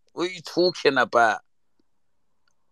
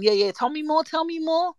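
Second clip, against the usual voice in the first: high, eager for more gossip; 11 semitones higher than usual. Retold or imitated. Begging the storyteller for more, high and eager. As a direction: lean in hungry for the story. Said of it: "I've gone".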